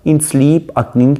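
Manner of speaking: stretched out and lifted; slow poem recitation. Shown as a demonstration. Teacher reads a sonnet line to the class, slow and lifted.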